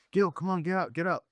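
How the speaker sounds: dad urging son out of bed; calling to wake. Retold or imitated. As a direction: rouse him from bed. Dad waking son again.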